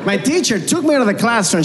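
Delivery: ordinary talk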